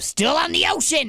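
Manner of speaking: high-pitched mock amazement; acted delight. Co-host acts out seeing the ocean, delighted. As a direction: exclaim it high, acting out wonder.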